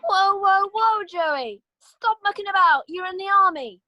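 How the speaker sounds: high, loud scolding yell; shouting at horse. Acted. Sergeant yelling at a runaway horse, loud and high. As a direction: bark him back into line.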